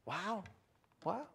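very soft amazement; hushed awe. Retold or imitated. Two travelers as Jesus disappears, hushed awe. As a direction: breathe it out in wonder.

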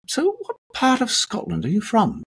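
high, put-on voice for the casting producer's question; mock producer voice. BBC producer quizzes nervous actor in audition, mock high inquiring voice. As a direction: ask it like a curious BBC producer.

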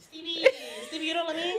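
laughing mock plea. Mocking Stacey pleading to Stevie.

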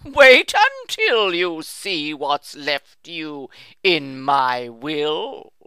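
a hint of a fond old uncle, chuckling. A narrator's reading. Uncle teasing his nephew with a chuckle. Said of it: "often chuckled".

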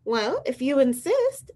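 high, slow, sweet reply; sweetly. Acted. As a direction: answer with sugary politeness, lifting at the end. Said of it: "answered the friendly ladybug sweetly".

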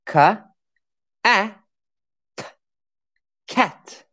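"Ka, a, t, cat" sounded out slowly letter by letter; sounding out. Shown as a demonstration. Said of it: "Say it together".

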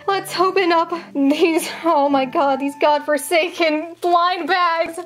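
usual speaking voice

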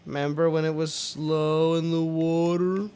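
words stretching out longer and longer. Pretend. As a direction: drag the last words out as if underwater. Mimics slowness of the water.

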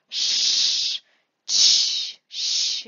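loud drawn-out hissing sh, ch, sh; hissing sounds. Shown as a demonstration. Teacher demonstrates the hissing letters aloud. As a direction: hiss each sound out clearly. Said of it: "kind of hissing letters".

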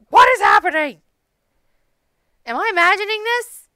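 loud, high startled disbelief; startled shout. Real, not acted. Gamer yelling at a sudden game event in alarm. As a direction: blurt it out in sudden shock.

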